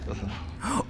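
sudden frightened gasp; quick scared intake of breath; sharp gasp. Real, not acted.

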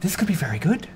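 high, stammering captain bubbling with hope; excited captain. Acted. Captain sharing a rescue plan with crew, high and excited.

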